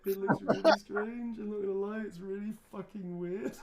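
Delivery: high, loud giggly words through laughter; cracking up at his own drawing; laughing. Real, not acted. Streamer laughs at his weird doodle, giggling.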